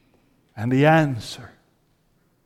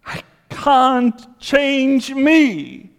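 higher and slow, a pleading plea; desperate cry; 9 semitones higher, much slower than usual. Acted. Desperate climax of Paul's lament.

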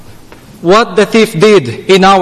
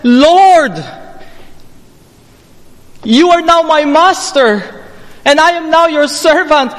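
higher, earnest vow of surrender; preacher voicing the criminal's surrender, raised and earnest; voicing the thief's submission; 7 semitones higher than usual. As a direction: speak as the thief yielding to his Lord. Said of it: "he says".

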